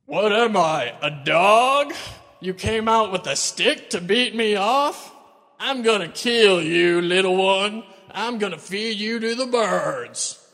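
loud, slow, mocking bellow of a giant; a giant jeering at a boy with a stick, loud and slow; Goliath yelling. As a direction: roar the insult down at the boy. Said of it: "he starts yelling out".

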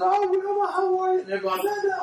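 high-pitched chatty women, imitated; gushing women's greeting. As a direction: squeal hello like a gushing old acquaintance. Imitates women greeting each other.

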